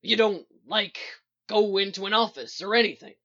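loud, gruff, upset question; rough-voiced, hurt disbelief. A narrator's reading. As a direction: press on, sounding bothered. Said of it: "He sounds upset".

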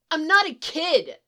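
loud, high offended outburst. Acted. Boy objects to being called kiddo, offended. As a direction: protest hotly as an insulted child. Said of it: "sounding offended".

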